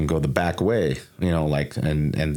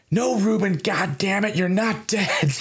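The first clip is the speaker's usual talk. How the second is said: higher imitated dad, exasperated; dad scolding; slower than usual. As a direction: play the fed-up father snapping at his son. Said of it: "And he goes".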